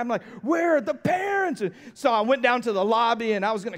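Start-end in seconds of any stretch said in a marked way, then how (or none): 0.4-1.6 exasperated, high-pitched demand; retold angry cry for the parents; so mad. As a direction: replay the outrage, voice jumping up. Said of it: "I'm, like, so mad".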